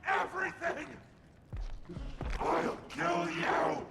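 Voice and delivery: high, loud raging shout; furious scream. Acted. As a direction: scream the threat. Monster screams threats.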